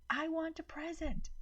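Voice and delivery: high, piercing tantrum cry; shrieking child. A narrator's reading. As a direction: shriek demandingly like a spoiled child. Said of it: "Maddie started shrieking".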